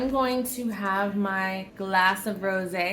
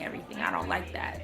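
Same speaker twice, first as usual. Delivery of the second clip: whispered under the breath; a whispered afterthought; 13 semitones lower, 7 dB quieter, faster than usual. Real, not acted. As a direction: drop to a whisper. Trailing germifying complaint.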